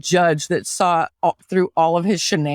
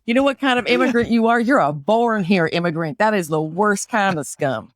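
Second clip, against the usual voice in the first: put-on Southern accent. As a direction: play a needling Southerner with a thick accent. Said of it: "can you do an Appalachian accent".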